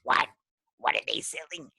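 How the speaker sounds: put-on a deaf old granny shouting her question; SpongeBob grandma. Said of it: "the grandma that comes out in the wheelchair is like".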